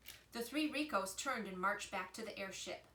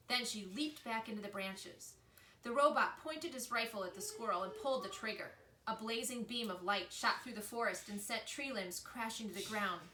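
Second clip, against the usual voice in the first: ordinary delivery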